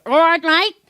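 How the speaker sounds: squinting Aussie greeting, high and loud; Australian accent. Shown as a demonstration. Londoner squinting to turn their greeting Aussie, Australian accent. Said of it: "it's Aussie".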